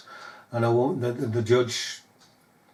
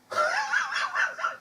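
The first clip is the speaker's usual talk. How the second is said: scornful shouting laugh; 7 dB quieter than usual. Real, not acted. Derisive laugh after really.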